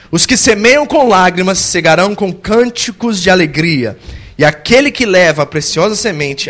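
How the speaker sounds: loud, measured Bible reading; scripture reading. Acted. Preacher proclaiming the psalm loudly to the congregation. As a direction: proclaim the verse to the church.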